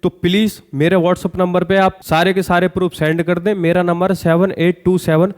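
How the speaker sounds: usual speaking voice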